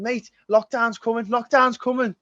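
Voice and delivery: high-pitched mock alarm; retold doom warning, pitched right up; imitated frantic warning.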